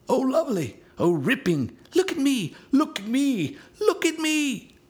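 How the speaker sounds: put-on excited child voices; joyful shouts. A narrator's reading. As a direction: squeal like flying children.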